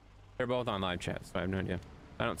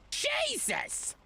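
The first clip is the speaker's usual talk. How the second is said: high squealed exclamation; a sudden high shriek of frustration; exasperated squeal. Real, not acted. Streamer squeals at the frozen computer, high and exasperated.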